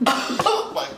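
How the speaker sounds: high, slow, laughing whine; laughing through onion tears. Real, not acted. Cook teary from cutting an onion, laughing whine. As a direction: laugh while your eyes sting.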